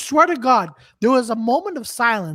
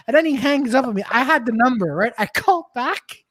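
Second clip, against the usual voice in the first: ordinary delivery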